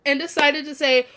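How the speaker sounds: normal talking voice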